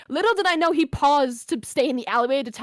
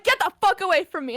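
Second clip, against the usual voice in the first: higher-pitched mild mock yell; imitated outburst in a raised pitch; retold angry warning; 6 semitones higher than usual. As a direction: lightly re-enact warning someone off. Retold warning to little boy.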